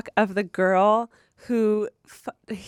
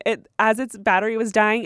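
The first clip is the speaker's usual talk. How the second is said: ordinary talk, nothing marked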